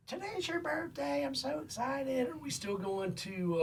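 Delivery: high, slow, excited mom voice; imitating mom. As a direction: mimic mom's giddy birthday call. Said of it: "she's like".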